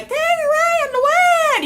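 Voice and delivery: very high, slow, loud wild voice; high slow wailing Nell voice; Jodie Foster as Nell. Retold or imitated. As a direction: wail it like the film character Nell. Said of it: "Jodie Foster and Nell".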